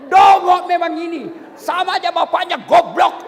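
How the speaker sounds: loud shouted abuse; angry insulting wife. Pretend. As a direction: hurl the insult at him. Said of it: "maki-maki, menghina suami". (istri marah memaki suami sambil berteriak)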